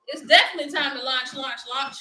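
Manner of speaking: ordinary delivery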